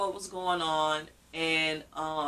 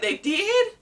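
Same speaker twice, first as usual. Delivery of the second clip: high-pitched, louder imitation of disbelief; mimicked surprise, rising sharply; surprised doctor; 14 semitones higher, 5 dB louder than usual. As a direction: act out his astonished question, pitch leaping up. Doctor surprised that clinic already called.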